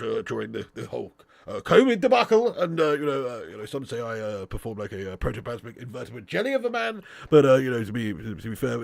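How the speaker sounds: low, bumbling politician impression with filler noises; hesitant, mumbled bluster with sudden bursts on key words; Boris Johnson. Streamer mimicking a politician for a viewer, bumbling impression. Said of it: "do an half-decent impression of both of them".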